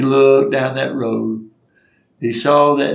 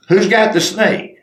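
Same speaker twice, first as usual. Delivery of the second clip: higher-pitched call to the others; mildly raised, searching shout; acted calling out; 8 semitones higher, 4 dB louder than usual. Crew hunting the lost snake.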